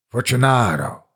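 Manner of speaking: calling out. Acted. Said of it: "I called aloud".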